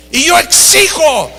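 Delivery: high, mock-imperious outburst; imperious demand. Famous preacher makes demands of airline clerk, imitated imperious outburst. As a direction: demand it like an entitled big shot.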